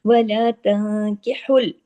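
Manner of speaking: slow, drawn-out tajweed recitation; teacher chanting the verse slowly for students; Quranic recitation. Shown as a demonstration. Tajweed teacher reciting the verse slowly for students. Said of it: "काफ के ऊपर खड़ी जबर है".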